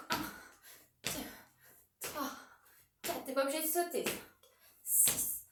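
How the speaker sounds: counting reps under the breath; counting workout reps. Shown as a demonstration. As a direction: quietly count each squat rep. Counting squats during challenge.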